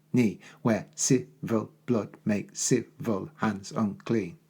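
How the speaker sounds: slow and deliberate, each syllable of "Two households" spaced out; counting syllables. Shown as a demonstration. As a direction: spell out every beat so it can be counted. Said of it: "Count them out on your fingers".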